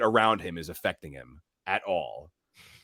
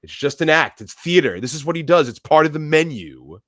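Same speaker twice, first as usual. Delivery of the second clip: emphatic, loud, raised-pitch reassurance; insistent; 6 semitones higher, 9 dB louder than usual. Retold or imitated. Guest telling the room it is only theater, loudly insistent. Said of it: "Lillian is insistent that it is not".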